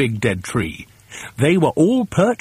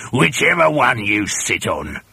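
menacing Mister Twit; 2 semitones lower than usual. Acted. Villain's threat in story.

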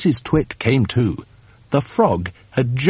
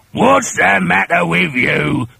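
put-on higher, louder old man voice; gruff husband; 6 semitones higher, 4 dB louder than usual. A narrator's reading. Husband feigns innocence at her scream, gruff old man. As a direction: call over from the other bed. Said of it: "Mister Twit said".

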